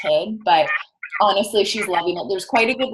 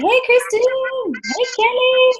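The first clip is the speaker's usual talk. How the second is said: high, slow squeaky greeting; slower than usual. Acted. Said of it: "To get my annoying squeaky voice out".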